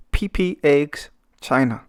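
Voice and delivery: ordinary talk, nothing marked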